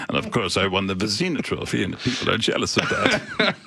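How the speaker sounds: giggly cartoon goalie boasting; Kipper character voice. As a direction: ham up the smug goalie. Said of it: "that was sort of a character voice".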